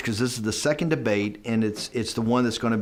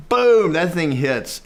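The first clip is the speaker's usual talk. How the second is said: louder, slower, higher punch; dramatic boom; 6 semitones higher, 6 dB louder, slower than usual. Retold or imitated. Telling how the tape hit, slow, loud boom. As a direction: land the boom like an explosion.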